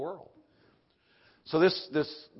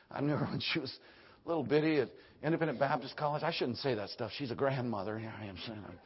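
usual speaking voice

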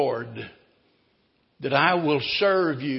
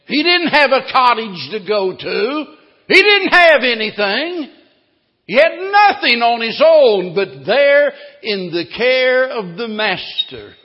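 loud, high fiery preaching; 7 semitones higher, 11 dB louder than usual. Acted. Preacher to congregation, fiery and loud. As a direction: drive the point home at full force.

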